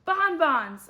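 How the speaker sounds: loud, high burst of excitement; shouted. A narrator's reading. Arlo shouting his great idea to the class, loud. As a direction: blurt it out as a big shout. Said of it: "I shouted".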